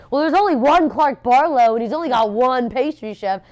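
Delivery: high, loud, rushing realization; excited realization. Retold or imitated. To her assistant, a high loud excited realization. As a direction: blurt it out as it clicks. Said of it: "I was like".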